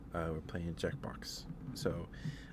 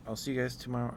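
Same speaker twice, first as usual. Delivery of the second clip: usual speaking voice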